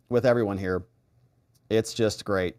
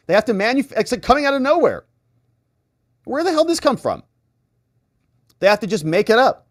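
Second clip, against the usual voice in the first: much higher, louder indignant rant; incredulous outrage; 8 semitones higher, 4 dB louder, faster than usual. Real, not acted. Streamer ranting at political claims, high and incredulous. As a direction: scoff at it in disbelief.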